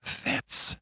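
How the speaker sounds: hushed, voiceless gasp of a name; startled whisper. A narrator's reading. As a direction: whisper it in shock. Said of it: "in a startled whisper".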